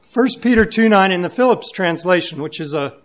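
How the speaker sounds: loud, emphatic sermon voice; booming pulpit delivery; full-force preaching. Acted. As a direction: preach it to the congregation.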